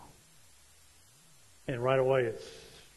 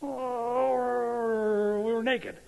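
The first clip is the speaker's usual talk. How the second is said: sheepish Adam; 10 semitones higher, much slower than usual. Retold or imitated. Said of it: "Adam answers God".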